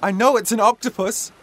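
high, loud and fed up; shouting back in frustration; exasperated shout. A narrator's reading. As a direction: yell back at Dad in annoyance. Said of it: "shouted Mum".

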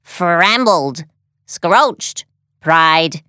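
lightly voiced happy robot; robot happily parroting new words, mangling them. A narrator's reading. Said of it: "the robot repeated happily".

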